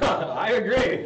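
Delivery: laughing through the words. Real, not acted. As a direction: agree while still laughing. Laughing agreement after teasing.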